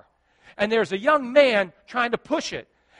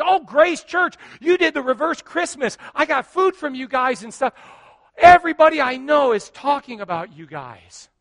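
excited recognition; 7 semitones higher, 9 dB louder than usual. Retold or imitated. Grateful woman recognizes his church.

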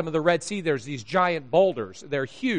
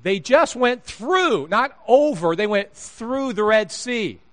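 slow and higher, "through" punched against "over"; stress on THROUGH; 5 semitones higher, slower than usual. Real, not acted. Preacher marvelling at the Red Sea, stress on "through".

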